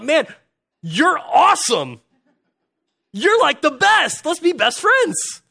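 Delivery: high, loud, drawn-out fanboy gush; excited gushing. Acted. Speaker hailing somebody who shares all his views, gushing excitedly. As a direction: shout it with over-the-top delight. Acted gushing at a like-minded person.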